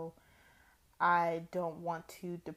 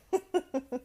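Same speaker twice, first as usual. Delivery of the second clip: laugh; 8 semitones higher, 4 dB louder than usual. Real, not acted.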